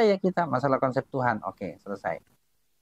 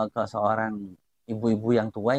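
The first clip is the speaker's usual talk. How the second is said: normal talking voice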